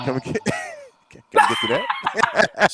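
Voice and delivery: breaking into high laughter mid-question; laughing. Real, not acted. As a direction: laugh your way through the question.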